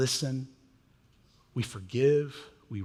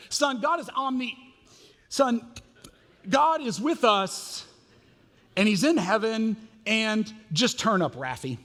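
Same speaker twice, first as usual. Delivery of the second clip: exasperated dad, pitched up and rising; a dad losing the thread with his four-year-old, pitched up and flustered; 7 semitones higher, 5 dB louder than usual. Retold or imitated. In the car, a pastor dad flustered by his son's questions.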